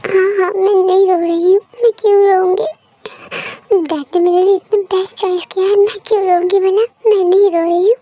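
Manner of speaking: tearful denial. Acted. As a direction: protest through tears that you're fine. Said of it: "ऐसे रोते हुए ठीक नहीं दिखाई देता". (रोते हुए इनकार)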